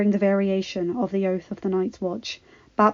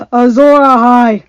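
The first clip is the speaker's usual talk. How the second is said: louder bellow; 4 semitones higher, 13 dB louder than usual. Acted. Grey Worm raising his spear, bellowing. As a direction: bellow from the chest. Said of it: "bellowed Grey Worm".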